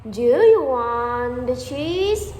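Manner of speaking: drawn-out, overly careful offer; slow careful question. Retold or imitated. French cook slowly offering cheese to Korean colleague, careful drawn-out question. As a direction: spell it out slowly for a foreigner.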